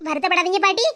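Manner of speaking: ordinary delivery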